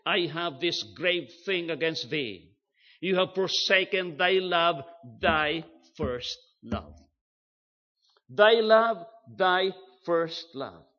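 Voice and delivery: slow, weighty stress on "thy first love"; emphatic repetition. Shown as a demonstration. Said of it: "To emphasize, you repeat it".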